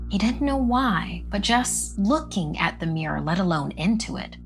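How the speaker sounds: ordinary delivery, nothing marked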